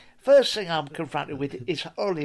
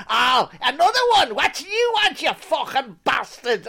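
shouting, higher, ranting mock Paul Hollywood; gruff Hollywood; 6 semitones higher, 5 dB louder than usual. Guest voicing the baker snapping at a viewer, mock rant. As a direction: snarl it like an unhinged hermit baker. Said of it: "He's gone".

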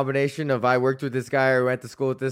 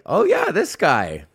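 mock acquaintance; 5 dB louder than usual. Imitating Facebook well-wishers in a mock surprised voice. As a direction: play the half-remembering friend, pitch leaping. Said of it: "people that wish you happy birthday are like".

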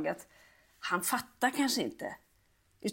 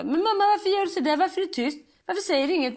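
put-on higher, louder worried kid's voice; pleading child; 7 semitones higher, 4 dB louder, faster than usual. Son asking mum why she's silent, pleading. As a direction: play a small boy begging mum to answer. Voicing son Pelle in story.

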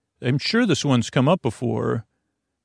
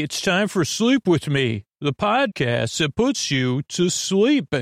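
higher announcer read of the title; 6 semitones higher than usual. Acted. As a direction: announce the show name.

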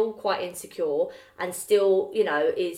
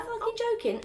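high-pitched disbelief; can't believe it; incredulous; 14 semitones higher, 4 dB quieter than usual. Retold or imitated. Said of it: "I was like".

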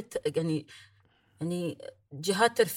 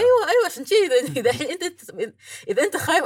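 much higher and louder, laughing; excited laughing; 7 semitones higher, 8 dB louder than usual. Real, not acted. Host eagerly warning co-host about Disney, excited laughing.

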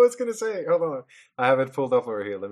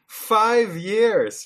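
mock indignation; 10 semitones higher, 4 dB louder than usual. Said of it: "echoing the character's complaint".

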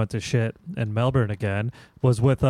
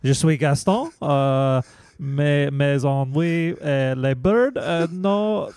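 halting, hammed-up French accent; mock French accent. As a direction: fumble for words like a comic Frenchman. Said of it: "get that accent from you again".

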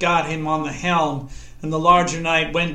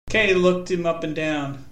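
ordinary delivery, nothing marked

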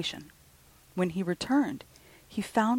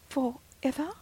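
solemn question; 8 semitones higher than usual. Acted. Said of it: "said the stranger solemnly".

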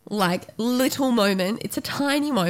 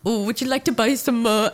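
weird put-on British accent, amused; fake British accent. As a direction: imitate a silly British accent with a laugh. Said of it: "doing this weird fake, like, British accent".

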